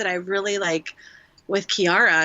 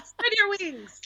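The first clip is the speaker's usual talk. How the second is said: laughing; 13 semitones higher, slower than usual. Real, not acted. Mom laughing.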